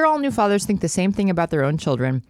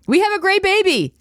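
high, sudden blurt; 13 semitones higher, 4 dB louder than usual. Acted. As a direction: blurt it out, much higher. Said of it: "blurted out the first words".

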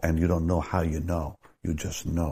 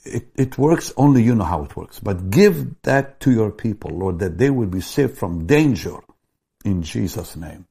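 raised, pleading prayer to the Lord, louder and higher; fervent prayer; 5 semitones higher, 6 dB louder than usual. Acted. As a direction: cry out to God for the people's protection. Pastor prays aloud for people.

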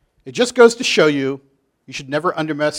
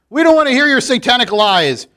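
put-on higher, louder chant of children; accusing children; 7 semitones higher, 5 dB louder than usual. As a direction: kids ganging up on the teacher. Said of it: "the children".

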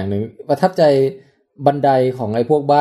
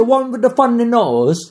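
silly put-on English accent, high; hammed-up English accent, much higher; สำเนียงอังกฤษเบ้อๆ; 10 semitones higher, much slower than usual. Showing off silly English accent again. (สำเนียงอังกฤษแกล้งเพี้ยน เสียงสูง)

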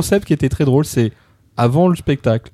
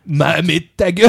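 mock shut up. Reacting to the singing.

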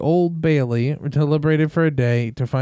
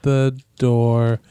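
every word dragged out slowly; much slower than usual. Shown as a demonstration. Plugging his show, told to go slower, very slow. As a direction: read the plug at half speed.

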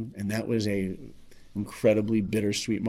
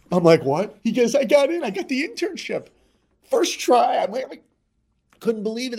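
retold excitement; 12 semitones higher, 6 dB louder than usual. Friend on the phone shouting the big news, excited and much higher. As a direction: voice jumps much higher and louder.

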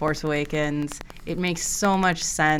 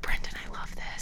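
whispering; 11 dB quieter, faster than usual. Retold or imitated. Retold whisper in the theater.

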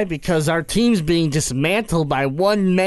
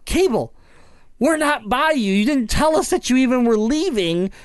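exasperated; 5 semitones higher than usual. Retold or imitated. Acts out exasperated reply to Cable.

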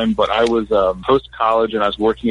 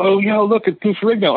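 excited spotting; 8 semitones higher than usual. Retold or imitated. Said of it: "So I go".

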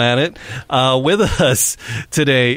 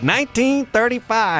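put-on old-timey prospector voice; 9 semitones higher than usual. Shown as a demonstration. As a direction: announce it like a gold rush prospector. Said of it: "You gotta do it in the old-timey prospector voice".

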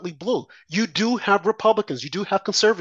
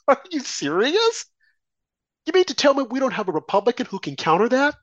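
incredulous; 6 semitones higher than usual. Retold or imitated. Exasperated quote of own reaction.

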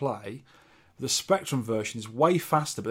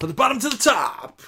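mock exclamation; 10 semitones higher, 5 dB louder than usual. Echoing co-host's phrase with gusto.

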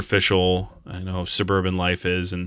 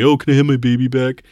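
mock whiny plea; 4 semitones higher, 6 dB louder, faster than usual. Mocking the movie's baby plot.

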